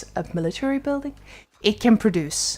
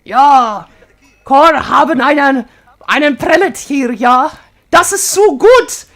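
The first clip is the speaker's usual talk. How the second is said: loud, hammed-up German accent spilling into real German; over-the-top German accent, louder and rising at the end; 3 semitones higher, 8 dB louder, slower than usual. Shown as a demonstration. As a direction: bark it out like a jolly German in German. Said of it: "if I do German accent, I keep switching back to German".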